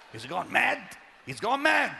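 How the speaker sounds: shocked whisper-turned-cry of the mourners. Retold or imitated. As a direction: mimic a startled crowd. Crowd's reaction imitated.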